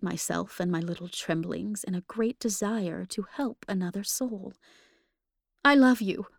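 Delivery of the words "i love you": higher, louder, tearful outcry; crying through tears. A narrator's reading. Woman crying out her love to the ghost, higher and louder through tears. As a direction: cry it out through hot tears. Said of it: "I cried through hot tears".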